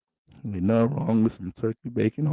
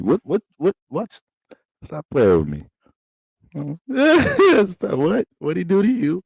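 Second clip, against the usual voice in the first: higher, rising what what; shocked boyfriend; 9 semitones higher than usual. Acted. Boyfriend hears girlfriend was conquered, shocked. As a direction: jump in alarmed, half joking.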